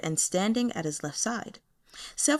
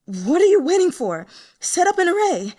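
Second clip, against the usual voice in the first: loud, high, urgent shout through the pain; wounded and furious, yelling an order; gritted-teeth command; 11 semitones higher, 9 dB louder than usual. A narrator's reading. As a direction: bark the order at her subordinate. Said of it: "Gritting her teeth".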